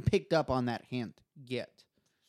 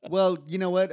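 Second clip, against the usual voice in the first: ordinary delivery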